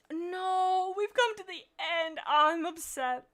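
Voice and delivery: loud, high wail of protest; upset outcry. Real, not acted. Reader reaching the end of the story, upset outcry. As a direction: cry out in playful dismay, drawing out the no.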